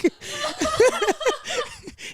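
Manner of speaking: a long high-pitched giggle. Real, not acted. Laughing about the fine deaf guy, high giggle. Laughing at her date story.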